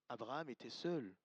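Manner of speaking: ordinary talk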